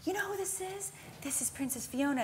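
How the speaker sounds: high-pitched parent introducing a star; eager parent. Retold or imitated. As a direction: imitate a parent talking up to a child. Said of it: "parents come up and go".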